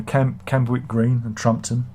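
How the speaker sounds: ordinary talk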